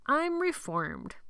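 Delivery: slow, high self-satisfied line; joke, waiting for a laugh; mock proud. Miguel joking to tired boys, mock proud.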